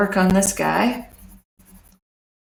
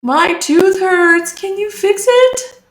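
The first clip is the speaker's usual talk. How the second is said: high, drawn-out cartoon dinosaur voice; toothachy dinosaur; 11 semitones higher, 5 dB louder, slower than usual. Dinosaur begging the traveling dentist for help, high and whiny. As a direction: plead in a high-pitched creature voice.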